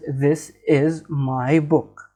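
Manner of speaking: each word slowly and evenly; slow example sentence. Shown as a demonstration. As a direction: read the example out slow and clear. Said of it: "First sentence is".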